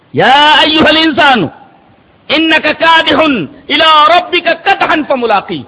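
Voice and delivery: slow, high, sustained verse recitation; ringing, drawn-out scripture recital; Quranic recitation. Real, not acted. Preacher recites closing Quran verse.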